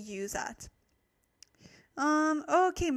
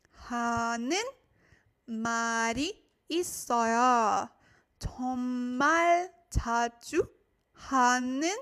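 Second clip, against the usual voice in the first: word by word with long pauses; slow chunked reading to repeat after; slow repetition. Shown as a demonstration. Teacher reading slowly so students repeat. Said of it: "repeat a little bit slower".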